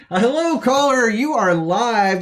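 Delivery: loud, high announcer welcome; hammy public access host hello; talk show host greeting. Acted. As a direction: boom it out like a cheesy TV host.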